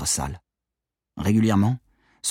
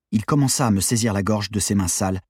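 ordinary delivery, nothing marked

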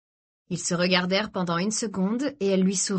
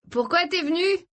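raised over music; 11 semitones higher than usual. A narrator's reading. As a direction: pitch it up to be heard over the noise. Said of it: "en essayant de se faire entendre malgré le volume sonore". (voix haussée pour couvrir la musique)

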